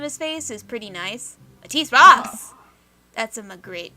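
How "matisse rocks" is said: loud, higher, overeager cheer; fake-confident yell about a painter; bluffing shout. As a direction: blurt it out to sound like you know art. Dad bluffs enthusiasm about art.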